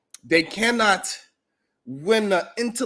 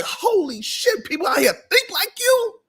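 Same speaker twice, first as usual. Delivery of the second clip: shocked; 13 semitones higher than usual. Retold or imitated. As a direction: say it in disbelief. Quoting own shocked reaction.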